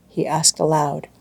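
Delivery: ordinary delivery, nothing marked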